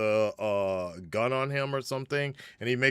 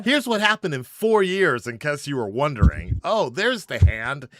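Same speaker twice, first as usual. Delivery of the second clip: mock recap announcer; 5 semitones higher, 7 dB louder than usual. Mocking the movie's quick recap.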